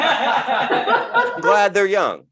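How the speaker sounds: slow, high gushing; mock enthusiastic declaration. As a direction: overdo the gladness. Exaggerated first take.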